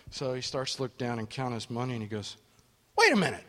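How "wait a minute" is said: surprised. Retold or imitated. Counting short money, realizing trick.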